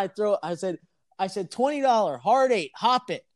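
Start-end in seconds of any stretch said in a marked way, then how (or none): 1.5-3.2 mock yelled bet. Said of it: "screaming at the guy".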